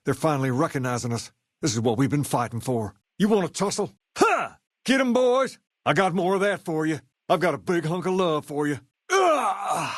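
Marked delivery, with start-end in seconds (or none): 3.2-8.8 high, keyed-up taunting mid-brawl; cocky brawler calling out to his foes; fight taunts. Acted. 9.1-9.9 sharp high grunt of being hit; high pained grunt. Acted. Hit during fight.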